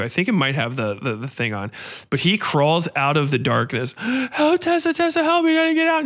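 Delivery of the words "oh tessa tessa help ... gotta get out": desperate begging. Retold or imitated. Injured man begging Tessa.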